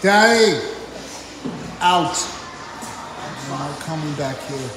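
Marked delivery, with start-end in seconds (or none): none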